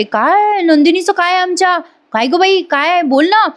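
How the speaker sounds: high-pitched acted alarm; anxious questioning. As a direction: play an anxious elder pressing for news. Mother-in-law anxiously demands news of Nandini.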